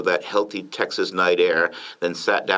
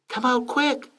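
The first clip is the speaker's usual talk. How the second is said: Lady Bird calling out. A narrator's reading. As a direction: call your husband in a light falsetto. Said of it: "she yelled to the former president".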